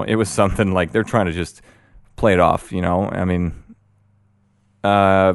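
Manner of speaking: ordinary talk, nothing marked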